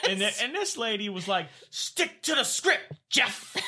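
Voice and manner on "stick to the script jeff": high, slow, put-on angry nurse; pissed nurse. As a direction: bite off each word at Jeff. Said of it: "She was so pissed".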